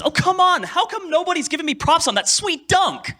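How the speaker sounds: high shouted anime complaint; original dub line. Retold or imitated. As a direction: yell it like the sulking player on court. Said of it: "the original line was".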